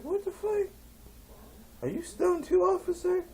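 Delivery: very high-pitched mock disbelief; incredulous challenge. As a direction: question the officer, voice shooting up. Incredulous challenge to officer, acted.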